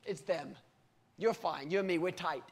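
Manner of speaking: a soft shrugging mock reassurance; mock dismissive God. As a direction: wave the worry away lazily. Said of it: "He didn't come and down and say".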